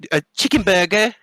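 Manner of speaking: high British lilt on "chicken burger"; mock English accent. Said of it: "they call it a".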